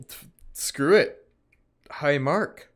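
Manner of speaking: words stretched by a yawn. Real, not acted. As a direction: yawn through it. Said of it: "stop freaking yawning".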